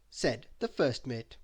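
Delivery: plain reading voice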